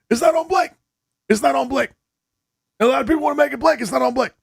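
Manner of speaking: high, fast mock coach insisting; lightly voiced Doc Rivers defending his player; Doc Rivers impression. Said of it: "I'm going full Doc Rivers here".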